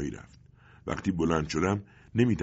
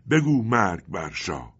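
loud, slow, raised angry urging; trembling with anger; 4 semitones higher, 6 dB louder, slower than usual. A narrator's reading. Stranger hugging the orphaned boy urges him, angry and loud. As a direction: urge it out in slow fury.

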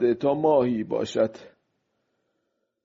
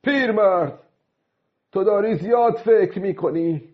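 raised, high-pitched voice; speaking aloud; 8 semitones higher, 4 dB louder than usual. A narrator's reading. Old fisherman addressing himself out loud, high raised voice. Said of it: "سپس با صدای بلند گفت".

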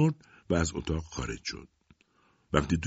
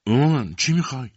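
higher, louder frightened plea to mother; a girl startled and scared by her mother; acted fear, voice raised; 6 semitones higher, 5 dB louder than usual. A narrator's reading. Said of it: "با وحشت سوال کرد".

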